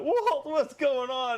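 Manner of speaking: high, drawn-out imitation of whining; slow, high mock wail; mock crying complaint. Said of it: "crying to Walt a little bit".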